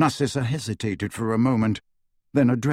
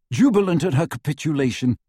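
ordinary delivery, nothing marked

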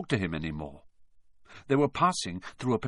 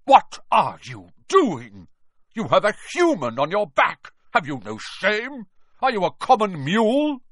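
loud, scornful, outraged tirade; angry shouting; 8 semitones higher, 5 dB louder than usual. Acted. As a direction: thunder the rebuke at a rival. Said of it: "Bane thundered".

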